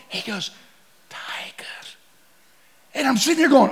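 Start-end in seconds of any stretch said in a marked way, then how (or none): 1.1-1.6 unvoiced, much softer whisper; whispered warning. Guide warning in the grass, whispered. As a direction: breathe it out so the tiger won't hear.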